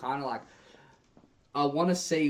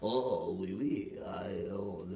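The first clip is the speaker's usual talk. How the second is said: mock French accent; 7 semitones lower, 8 dB quieter than usual. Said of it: "you have to say it in the".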